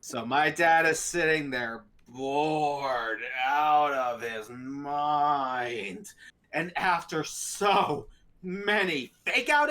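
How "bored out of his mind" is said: very slow, stretched-out words; drawn-out boredom. Retold or imitated. Telling how dad sat through the movie, drawn-out boredom. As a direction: drag each word out as if time has stalled.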